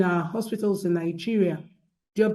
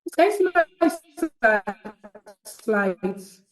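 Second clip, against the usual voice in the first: calling out; 4 dB louder than usual. Real, not acted. Asking about slides on call.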